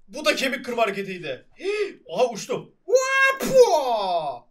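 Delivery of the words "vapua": loud high whooping cry; wild whoop. Real, not acted. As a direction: yell while flying off. Flying through the air in game.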